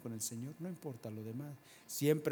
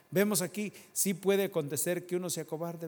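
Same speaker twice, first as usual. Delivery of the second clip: loud, higher preaching push; 5 semitones higher, 7 dB louder than usual. Real, not acted. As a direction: drive the point home to the church. Preacher raising voice.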